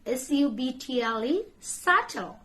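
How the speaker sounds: usual speaking voice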